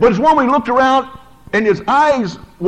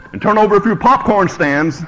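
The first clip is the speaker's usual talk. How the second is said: usual speaking voice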